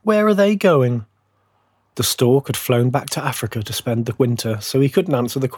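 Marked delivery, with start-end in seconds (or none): 0.0-1.0 high, plaintive little fir voice; moaning. A narrator's reading. Little fir asking after the chosen trees, high moan. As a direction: whine the question, pitched well up. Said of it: "moaned the little fir".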